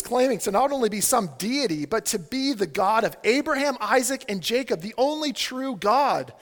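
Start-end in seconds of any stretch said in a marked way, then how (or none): none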